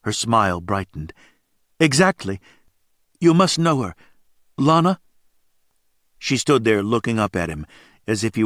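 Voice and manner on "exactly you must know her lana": lightly voiced young woman, higher and hopeful; woman's voice. A narrator's reading. A woman at the biker bar asks hopefully about Lana, lightly voiced. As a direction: ask it like a scared girl hoping for help.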